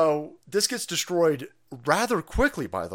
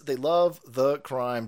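drawn-out, word-by-word delivery; slow, deliberate restatement; slow emphatic repeat; much slower than usual. Real, not acted. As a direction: spell it out slowly for the listeners. Repeats the jab slowly.

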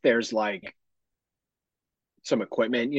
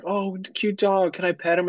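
put-on cooing girl voice; 7 semitones higher than usual. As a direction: play the sweet girl asking to pet. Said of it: "and like".

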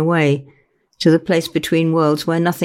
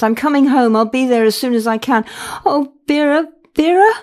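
high, rising toward tears; tearful; 8 semitones higher than usual. A narrator's reading. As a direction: hold back the tears, then break. Tearful daughter on the phone.

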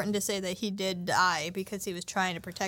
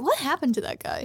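usual speaking voice

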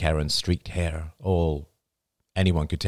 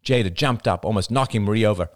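ordinary delivery

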